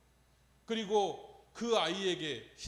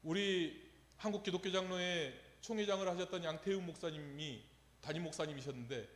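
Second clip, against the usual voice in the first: ordinary talk, nothing marked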